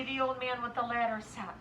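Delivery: plain reading voice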